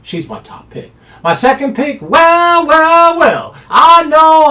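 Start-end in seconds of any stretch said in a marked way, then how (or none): 1.2-4.5 high, loud hyped-up call; excited hype. Real, not acted. Handicapper hyping his second pick, loud and high. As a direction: sell the pick like a showman.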